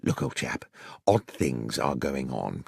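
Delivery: hushed whisper, slowing down; a secretive whisper at the front door. A narrator's reading. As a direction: whisper so the man downstairs can't hear. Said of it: "I whispered".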